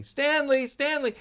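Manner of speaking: high, loud call for the lost duck. Acted. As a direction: shout his name through the house. Boy calls for his duck.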